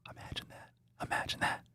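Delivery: hushed, voiceless whisper. Real, not acted. Streamer repeats an aside to himself, whispering. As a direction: breathe it out under your voice. Same words hushed, then aloud.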